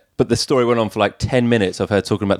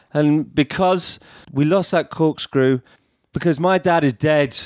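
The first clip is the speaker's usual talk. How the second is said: imitated drunk, slow and higher; drunk speech-maker; 6 semitones higher, slower than usual. Drunk best friend revealing a bereavement in her speech, slowly imitated. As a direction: drag out a tipsy confession. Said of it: "she was completely pissed".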